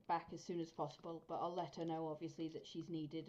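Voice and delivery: usual speaking voice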